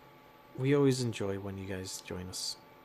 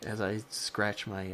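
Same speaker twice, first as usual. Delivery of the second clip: ordinary talk, nothing marked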